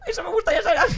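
mock crying. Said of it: "me puse a llorar también y yo".

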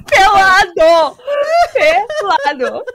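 slow, laughing exclaim; excited exclamation. Real, not acted. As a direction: stretch it out in disbelief.

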